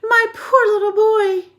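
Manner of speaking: high, loud, slow crying wail; distraught mother crying out; anguished cry. A narrator's reading. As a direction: wail over the frozen little boy. Mother sees shivering son.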